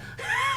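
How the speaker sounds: very high squealing laugh; shrieking laugh. Real, not acted. Cracking up at his own story, a high squeal. After punchline.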